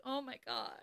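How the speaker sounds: hushed, touched reaction; moved. Retold or imitated. Fan recalls being overcome by a scene, softly moved.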